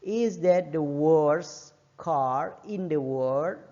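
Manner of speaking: very slow, stretched final model sentence; slow careful teacher demo; very slow model reading. Shown as a demonstration. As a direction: draw the whole question out for learners. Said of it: "dia nggak perlu S".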